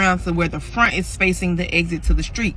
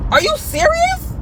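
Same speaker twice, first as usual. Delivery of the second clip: squeaky, much higher and slow in total disbelief; shocked disbelief; slower than usual. Retold or imitated. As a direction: go way up, stunned you'd even suggest it. Disbelief at having to tell mom.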